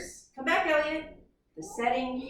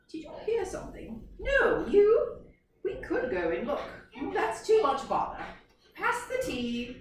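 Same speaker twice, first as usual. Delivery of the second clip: haughty giraffes; 7 semitones higher than usual. Acted. Giraffes ignoring the bears.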